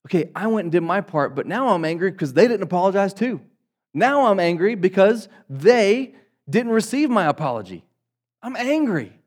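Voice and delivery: imitating a resentful churchgoer; mock angry complaint. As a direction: play the offended party, working up. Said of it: "we misunderstand to say".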